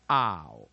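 slow, loud "ow", gliding from ah to oo; diphthong ow. Shown as a demonstration. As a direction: stretch ow as a two-part vowel. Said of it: "它本身也是两个念法哈". (ow 念成拉长的双元音)

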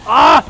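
a loud, sharp cry of rage; bursting out as the kill slips away; furious cry. Real, not acted. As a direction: rage yelp in the middle of a fight. Killed at the key moment.

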